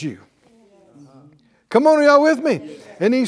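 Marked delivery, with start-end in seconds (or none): none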